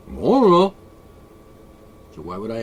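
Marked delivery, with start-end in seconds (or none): 0.1-0.7 clueless cat voice, louder; voicing the cat. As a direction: play the oblivious cat with a shrug in the voice.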